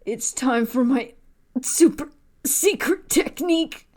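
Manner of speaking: dramatic anime voice. Pretend.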